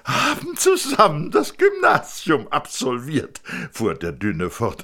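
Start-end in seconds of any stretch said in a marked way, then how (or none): 0.0-3.4 much higher, louder, proud fussing; a proud father showing off his family; thin man presenting wife and son; eager thin man. Acted.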